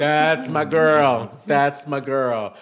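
drawn out, proud; slow proud approval. Real, not acted. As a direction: savor it slowly, proudly.

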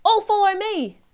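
high, loud rallying shout. A narrator's reading. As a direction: shout to lead everyone off.

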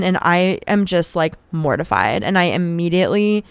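ordinary talk, nothing marked

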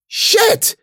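very high yelled curse; alarmed curse. Acted. Cece sees Ben collapse, panicked shriek. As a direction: yell it in panic. Friend collapses while fleeing.

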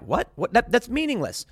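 high-pitched, stammered disbelief; baffled comeback, voice jumping up; acting out a dismissive retort; retold incredulity. Retelling his baffled reply.

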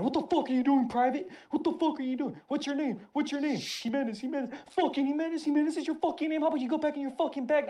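drill sergeant shouting. Retold or imitated. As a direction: scream it down at the recruit like a tiny furious sergeant. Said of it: "She's shouting".

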